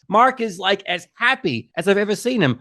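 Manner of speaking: ordinary talk, nothing marked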